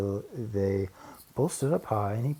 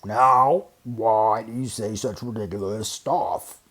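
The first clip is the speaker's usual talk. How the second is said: a mild gruff growl, loud on Now; lightly voiced gruff bear; 6 dB louder, slower than usual. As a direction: grumble like a big bear. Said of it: "growled a voice that was terribly gruff".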